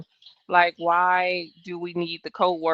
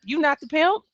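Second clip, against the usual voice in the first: ordinary talk, nothing marked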